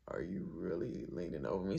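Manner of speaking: low, quiet put-on voice; deadpan low challenge; mock low voice. Confronting Jazz leaning over him, low mock voice. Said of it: "I'm like".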